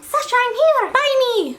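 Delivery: high-pitched voice of an innocent little book; pleading book. Acted. Book on the shelf calling to its buyer, high and pleading. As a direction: call out sweetly high, begging to be bought. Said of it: "sitting there so innocently on the bookshelf saying".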